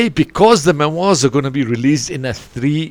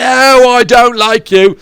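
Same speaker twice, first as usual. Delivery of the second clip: dismissive listener; 10 semitones higher, 9 dB louder, slower than usual. Retold or imitated.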